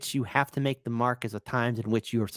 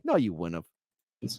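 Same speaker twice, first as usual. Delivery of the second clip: polite sarcasm; 10 semitones lower, 4 dB quieter than usual. Shown as a demonstration. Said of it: "politely and sarcastically".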